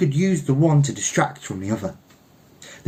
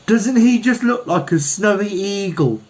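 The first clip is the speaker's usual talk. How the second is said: high, louder silly woman's voice; foolish gushing woman; 10 semitones higher, 4 dB louder than usual. Acted. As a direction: gush like a foolish onlooker.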